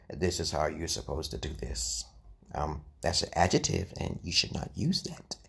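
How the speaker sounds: quiet, low, restrained lecturing voice; mimicking a stuffy professional teacher. Teacher mocking professionals who sit there and go, hushed prim imitation. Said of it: "I do not talk like that".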